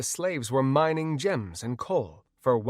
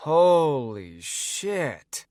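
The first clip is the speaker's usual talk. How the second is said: very slow, dragged-out drone; droning; 4 semitones higher, 3 dB louder, much slower than usual. A narrator's reading. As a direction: let it sag out bored. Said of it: "Trinity droned".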